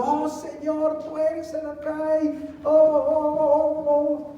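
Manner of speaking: high, slow, sung-out praise like an overzealous believer; mock ecstatic worshipper. Preacher mocking a worshipper who shouts his praise, high and sung. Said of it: "si alguien está gritando".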